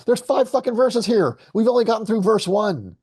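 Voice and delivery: high-pitched exasperated outburst. Real, not acted. Exasperated at slow progress through song.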